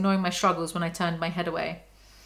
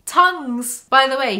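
excited; 5 semitones higher, 7 dB louder, slower than usual. Real, not acted. Reacting to the kiss.

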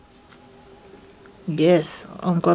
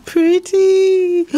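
high, loud admiring squeal; delighted cooing; 9 semitones higher, 5 dB louder than usual. Real, not acted. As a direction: coo loudly over something pretty. Admiring a trim.

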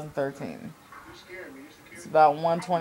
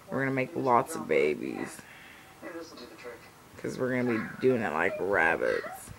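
lower, teasing suggestive voice; sweet nothings; 5 semitones lower, 4 dB quieter than usual. Pretend. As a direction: drop the voice, cheeky and suggestive. Suggestive joke to boyfriend.

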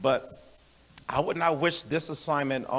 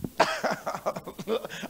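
pained laugh; 6 semitones higher, 5 dB quieter than usual. Real, not acted. Preacher laughing at his own hard truth, pained laugh. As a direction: laugh from pain and revelation. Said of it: "I'm laughing from pain and revelation".

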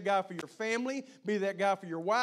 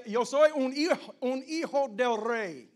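proclaimed in Spanish; 6 semitones higher, 7 dB louder, slower than usual. Acted. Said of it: "For those of my brothers that speak Spanish".

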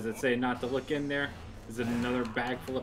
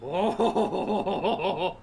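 high, pulsing belly-laugh "oh"; laughing whoop; 6 semitones higher, 4 dB louder than usual. Real, not acted. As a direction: burst out in a long rhythmic whoop of laughter. Exclamation turning to laughter.

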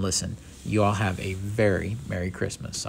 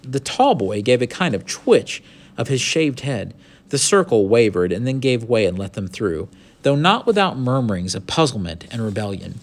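animated storybook reading, lively pitch; story narration; 4 semitones higher, 4 dB louder than usual. A narrator's reading. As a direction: read the adventure out with expression. Reads the story aloud.

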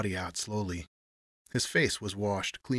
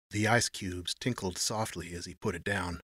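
ordinary delivery, nothing marked

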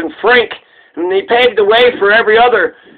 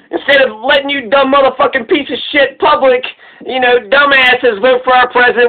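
normal talking voice

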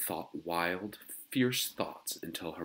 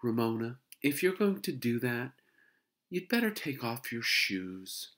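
tired calm mother; 6 semitones higher, 3 dB louder than usual. Acted. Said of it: "voice was tired but calm".

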